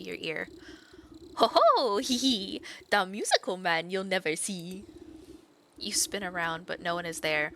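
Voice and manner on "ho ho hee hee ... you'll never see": mocking chitter. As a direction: cackle and taunt like an unseen imp. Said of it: "chitters mockingly".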